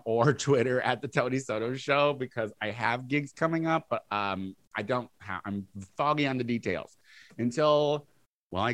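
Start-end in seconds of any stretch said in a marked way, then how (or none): none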